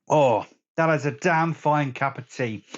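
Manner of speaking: ordinary talk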